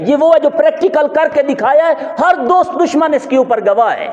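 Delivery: high, stretched sermon delivery; emphatic pulpit oratory; impassioned preaching. Real, not acted. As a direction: proclaim it to the congregation, pitched high. Preaches at full force.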